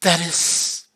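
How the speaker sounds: lightly voiced, spent and resolute; weary priestess. Seiko, just awoken, sending Akano away, soft and tired.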